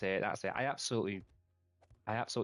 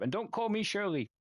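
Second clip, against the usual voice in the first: quoting a YouTuber; 9 semitones higher than usual. Retold or imitated. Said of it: "and he's like".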